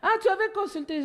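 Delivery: high, laughing astonished sister; surprised sister. Retold or imitated. Sister hears her healed voice, high astonished laugh. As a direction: play the amazed big sister. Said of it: "elle dit".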